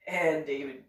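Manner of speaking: ordinary delivery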